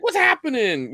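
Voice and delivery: high-pitched mock greeting; imitating video clerk. Clerk in a bad training tape greeting a customer, hammed-up. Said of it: "he's like".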